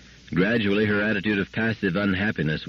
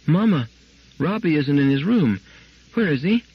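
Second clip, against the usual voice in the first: put-on frightened little girl; 8 semitones higher, 4 dB louder than usual. A narrator's reading. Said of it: "a frightened little girl".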